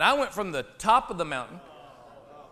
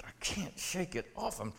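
breathy despairing whisper; barely voiced admission; 11 dB quieter than usual. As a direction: let it fall to a whisper.

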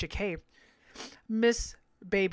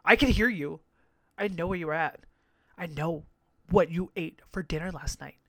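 normal talking voice

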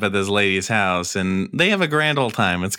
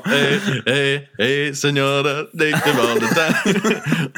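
slow, lightly voiced ghost greeting; hammed-up ghosts calling to the senora; mock ghost voice; 2 semitones higher, slower than usual.

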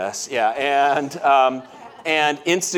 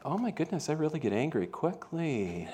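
quiet self-mocking laugh; 10 dB quieter than usual. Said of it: "it's like".

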